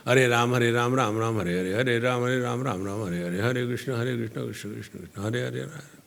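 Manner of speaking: rattled-off low mantra chant, fading away; mechanical japa. Acted. Devotee getting the japa count done, mechanical mumbled rush. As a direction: race through it, low and soft, trailing off.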